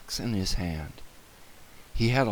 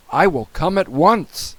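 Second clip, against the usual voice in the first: slightly urgent, loud and higher; decisive policeman; 5 semitones higher, 6 dB louder than usual. A narrator's reading. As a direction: promise help firmly. Policeman answers emergency.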